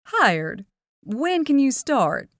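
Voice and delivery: a hint of a chatty diner waitress; Southern waitress Dory. Dory chats to the teens about her sweet tea, lightly Southern.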